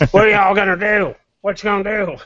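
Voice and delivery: high, loud mock drunk bellow; drunken yell. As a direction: shout it like a rowdy drunk at the bar. Drunk self at party, shouting.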